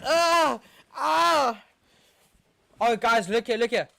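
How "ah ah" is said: hammed-up high yelps of fake pain; mock pain yelps. Fan mocking a diving player with fake yelps. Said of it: "knocking into the mic and going".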